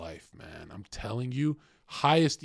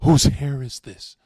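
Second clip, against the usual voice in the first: freaking out; 4 semitones higher, 14 dB louder, slower than usual. Retold or imitated. Girlfriend freaks out in car.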